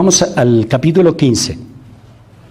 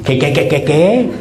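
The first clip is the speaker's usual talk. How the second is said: startled priest; 3 semitones higher than usual. Retold or imitated.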